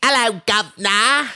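a hint of a Cockney hello; mock British greeting. [name] greeting the host in mock British accent. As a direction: drop the h like a Cockney. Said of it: "We got [name]".

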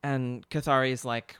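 normal talking voice

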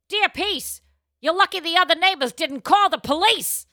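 high, loud mock-stern reply; hammed-up advice column answer; advice columnist reply. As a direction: read the reply like a sassy columnist.

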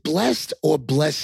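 "blessed" as one syllable, then two; "blessed" said both ways, distinctly; two pronunciations. Shown as a demonstration. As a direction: contrast "blest" with "bless-ed". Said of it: "is it blessed or blessed?".

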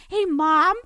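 falsely casual, lilting greeting; mock phone greeting. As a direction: act out an awkward call home. Imagined awkward call to mother.

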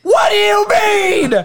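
very high, hammed-up screaming protest; indignant yelling. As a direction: yell back in outraged disbelief. Acts out his yelled reply.

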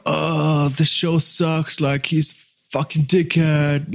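lightly acted disgusted griping; mock disgusted critic. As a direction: imitate a scornful heckler, slowly. Acts out a nasty commenter.

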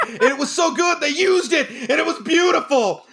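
high-pitched, hammed-up rapture; mock gushing. Teacher parodying raves for a middle school play, mock-ecstatic. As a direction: gush as if it were a triumph. Mocking an overblown rave review.

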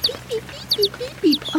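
high, repeated chick beeps; small high-pitched bird cheeps; imitated chick peeping. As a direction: peep like a helpless baby chick.

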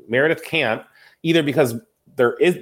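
usual speaking voice

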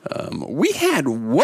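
lightly voiced old woman; old lady voice. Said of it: "Don't, don't do that voice".

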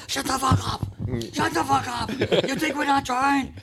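high-pitched imitation of an angry yell; imitated yelling. Player Jake yelling back at the heckler in the crowd, high retold yelling. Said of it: "he would be yelling".

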